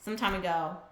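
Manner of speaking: low, soft, quick narration; understated brisk story reading; reading game narration. A narrator's reading. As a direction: read it fast and quietly, evenly.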